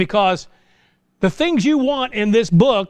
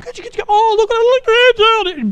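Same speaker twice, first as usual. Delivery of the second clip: doting grandparent; 6 dB louder than usual. Retold or imitated. Preacher mimics grandparents cooing over a baby, high and gushing. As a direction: coo over the new grandchild.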